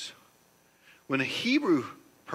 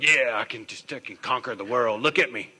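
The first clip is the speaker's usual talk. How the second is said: mock boastful guy; 7 dB louder than usual. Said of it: "guys are like".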